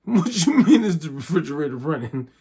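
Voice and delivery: half-laughing, can't believe it; laughing disbelief. Retold or imitated. Narrator laughs back at coworker's strange fridge question. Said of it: "And so I wanna laugh".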